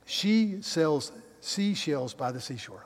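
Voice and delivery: slow, careful tongue twister; real slow. Shown as a demonstration. Preacher carefully saying a tongue twister he struggles with, slow. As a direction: pick through each word slowly. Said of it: "I've gotta say it real slow".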